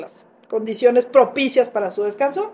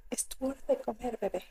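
put-on soft talk to baby; 14 dB quieter than usual. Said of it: "Y yo".

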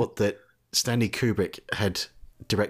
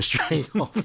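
We're straying off said through a laugh, higher; amused, half-laughing repeat; 7 semitones higher than usual. Real, not acted. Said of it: "We're, we're straying off".

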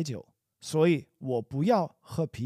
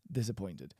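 ordinary talk, nothing marked

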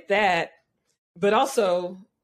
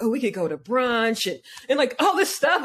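higher-pitched mock excitement, climbing as it goes; eager daydreaming; 7 semitones higher than usual. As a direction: gush through an imagined plan, getting carried away. Imagining eager plans with a match.

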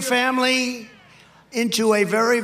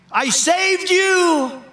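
high, slow, louder anguished woman; anguished cry; 10 semitones higher, 5 dB louder, much slower than usual. Acted. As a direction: cry out at the betrayal. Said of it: "cried the woman".

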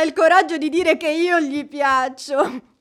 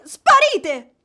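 ordinary talk

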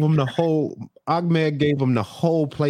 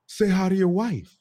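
higher, cocky parroting of an order; puffed-up echo; 5 semitones higher than usual. Retold or imitated. Wife with an ally echoes to her husband, puffed-up. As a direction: echo it smugly, backed by an ally. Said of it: "puff her chest out a little bit".

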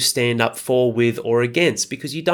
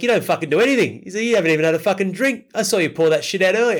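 higher, mocking half-cut mate voice; drunk mate ripping into him; 7 semitones higher than usual. Tipsy mate calling out a fake drinker, higher mocking voice. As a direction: imitate your tipsy mate tearing strips off him. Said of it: "just fucking hooked into him".

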